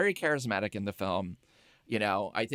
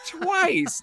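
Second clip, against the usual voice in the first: TWICE pitched way up and louder; incredulous emphasis; 5 dB louder than usual. Real, not acted. Friend marvelling at the film's repeated slip, emphatic high TWICE. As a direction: hit "Twice" high and hard in disbelief.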